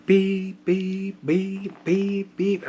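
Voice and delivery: high, insistent monitor beeps; imitated alarm beep. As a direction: mimic a sensor alarm on one high pitch. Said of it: "That's a different beep".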